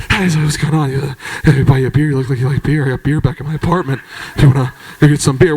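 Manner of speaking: low, mumbling pickup line; low talker. Retold or imitated. Creepy barfly offering beer at the bar, low mumble. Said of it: "the only reason you can hear it is because I have a fucking microphone".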